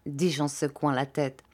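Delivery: plain reading voice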